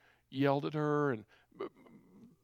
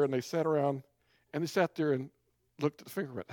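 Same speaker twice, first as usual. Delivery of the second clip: ordinary talk, nothing marked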